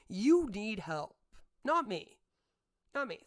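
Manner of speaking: emphatic rebuke; angry. Real, not acted. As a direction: hammer it home. Angry punchline.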